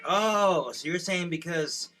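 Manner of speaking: indignant dad. Retold or imitated. Dad retorting to mom about his car, indignant. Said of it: "my dad's like".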